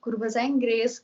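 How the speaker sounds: lightly acted, high "oh yes"; pleased realisation. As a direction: imitate someone welcoming a timely coincidence. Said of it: "dia bilang".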